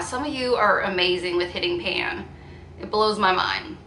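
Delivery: ordinary talk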